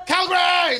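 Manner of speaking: high city-name holler; screamed out loud. Shown as a demonstration. Comedian tests Calgary as a city to scream, high shout. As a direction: shout it high, trying it out as a crowd cry. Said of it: "No, don't sound right".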